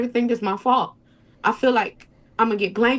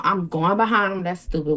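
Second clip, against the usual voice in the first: ordinary talk, nothing marked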